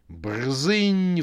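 high, loud chainsaw noise; chainsaw roar. A narrator's reading. Clown's chainsaw roaring over Yulia. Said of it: "взревела бензопила".

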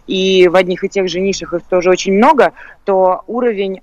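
usual speaking voice

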